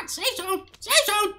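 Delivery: squealing the announcer's call; parroting the announcer. Retold or imitated. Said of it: "full parrot".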